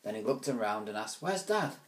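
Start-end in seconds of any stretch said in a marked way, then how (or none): 1.2-1.8 put-on much higher boy's voice; boy's question. Ruskin asking his mother where Dad is, higher voice. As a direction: ask brightly. Boy asks.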